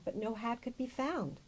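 usual speaking voice

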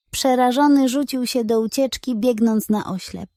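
ordinary delivery, nothing marked

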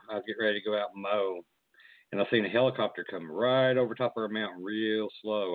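ordinary talk

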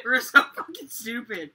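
laughing through the words; giggling while talking. Real, not acted. Laughing after being slapped.

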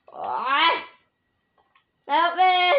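loud high cry for help; shouting for help. Real, not acted. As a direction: yell for help in a panic. Calling for help in game.

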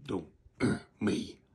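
ordinary talk

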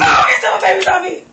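loud panicked shout. Real, not acted. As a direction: yell it out in alarm.

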